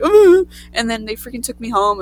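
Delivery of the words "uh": high, loud wordless mock wail; imitated sobbing. Kid answering questions in police car, imitated sobbing. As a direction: answer with a fake blubber. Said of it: "I just answered, like".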